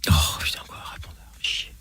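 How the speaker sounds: whispered cursing under breath; muttered swearing. Real, not acted. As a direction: curse quietly to yourself.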